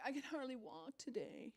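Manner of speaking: high, whiny self-pity, lightly done; mock whiny complaint. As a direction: whimper like a hurt martyr. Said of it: "somebody else ... maybe bumps into you".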